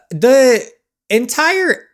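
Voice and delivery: loud, high drawn-out lead-in; emphatic buildup. Real, not acted. Player starts ranting about the gray area, loud. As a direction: wind up for a rant.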